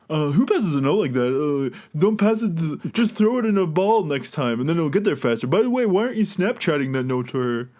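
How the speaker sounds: lightly voiced snarky video critic; a hint of an internet reviewer mocking; snarky YouTuber voice. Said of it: "who is that guy doing that voice".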